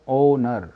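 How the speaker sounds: "ओनर" repeated with O as first sound; silent H. Shown as a demonstration. Teacher answers own question, "ओनर" with silent H. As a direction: leave the H out of "ओनर". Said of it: "उच्चारण क्या आ रहा है".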